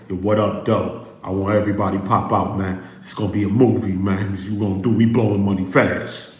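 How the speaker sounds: low-pitched imitation of a party promoter; Big Meech. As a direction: drop down deep and call everybody out.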